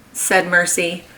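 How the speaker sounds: plain reading voice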